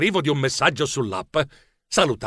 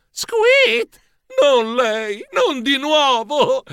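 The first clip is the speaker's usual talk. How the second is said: high squeak of comic dread; squeal, then groan in dismay; high-pitched panicked lament; terror; 9 semitones higher, much slower than usual. A narrator's reading. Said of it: "mi accorsi con terrore".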